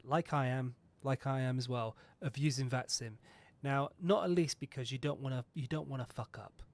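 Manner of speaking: ordinary delivery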